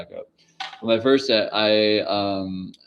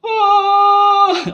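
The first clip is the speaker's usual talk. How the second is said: high loud slow-motion yell; Austin Powers yell; 9 dB louder than usual. Pretend. Imitating Austin Powers for viewers with a high slow-motion yell. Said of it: "Like Austin Powers".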